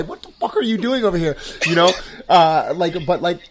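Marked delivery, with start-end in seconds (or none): 0.0-1.4 tough mocking wife. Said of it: "a hard-nosed Brooklyn chick".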